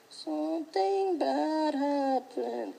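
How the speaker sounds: put-on very slow, hushed little kid; small innocent kid voice. Imagined kid telling mom, slow and hushed. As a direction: whimper it like a timid child.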